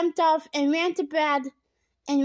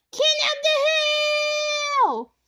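shouted; 3 dB louder, faster than usual. A narrator's reading. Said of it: "Bradley shouted".

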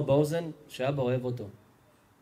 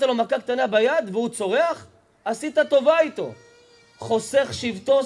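louder and higher, hammering each point; fiery preaching; 6 semitones higher, 6 dB louder than usual. Acted. Rabbi exhorting parents to discipline children, loud and high. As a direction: preach it hard to the hall.

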